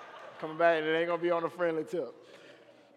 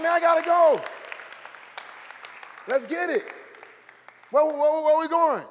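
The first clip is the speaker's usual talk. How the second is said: eager shout; 10 semitones higher, 8 dB louder than usual. Acted. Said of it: "You're like".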